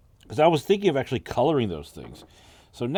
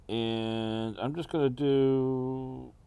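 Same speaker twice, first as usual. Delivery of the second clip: slow, held-breath concentration; holding breath; 4 dB quieter, much slower than usual. Real, not acted. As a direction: speak while holding the breath in. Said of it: "holding my breath here as I'm doing this".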